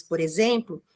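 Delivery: usual speaking voice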